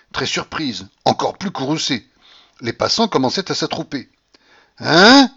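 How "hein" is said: loud, high, rising grunt; redoubled angry astonishment. A narrator's reading. Baron to his provoker, doubly astonished and angry. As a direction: blurt it out in outraged disbelief. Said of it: "grogna de nouveau le baron en redoublant d'étonnement et de colère".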